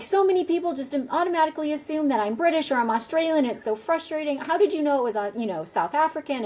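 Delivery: high-pitched, excited retelling of the husband; gush, pitched well up, as the thrilled client; imitating an excited older man. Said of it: "he was so excited".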